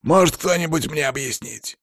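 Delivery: high, exasperated demand; angry boss shouting. A narrator's reading. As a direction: demand an explanation from your staff. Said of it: "крик Палыча".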